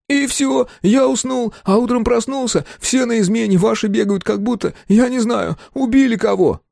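high-pitched, tearful babbling; plaintive, panicky chatter; whining gabble. A narrator's reading. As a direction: whine it out in a nervous stream. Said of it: "плаксиво затараторил".

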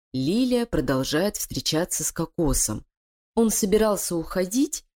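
usual speaking voice

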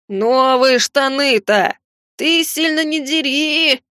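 slow, whiny sobbing plea; whimpering. Acted. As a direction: whimper it with mournful longing. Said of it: "всхлипнул Жердо с тоской". (жалобно всхлипывая)

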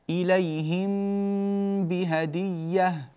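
very slow, hidden meem before بهدية; drawn-out recitation with the meem hidden; nasal hold on the meem. Shown as a demonstration. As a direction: hum the meem of إليهم into بهدية. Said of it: "الميم مع الباء".

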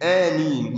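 آمين with long aa and undoubled m; single light m. Shown as a demonstration. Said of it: "وبعدها بدون تجديد". (آمين بميم مخففة بدون تشديد)

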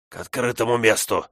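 rasped urgent call; hoarse. A narrator's reading. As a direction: croak it out breathlessly. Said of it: "прохрипел Корлис".

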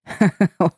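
men roaring with laughter; hearty laugh. A narrator's reading. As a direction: burst out laughing. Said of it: "раскачивались и смеялись".

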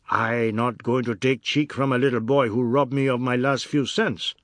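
loud, blustering outrage; angry fat man. Acted. Fat man complains about being robbed, loud outrage. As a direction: bluster loudly at a cheeky boy.

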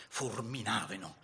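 usual speaking voice

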